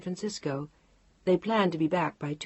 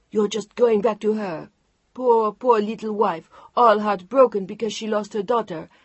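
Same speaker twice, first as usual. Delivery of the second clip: furious outburst; 5 semitones higher, 8 dB louder than usual. Acted. Chantal furious at Mark leaving.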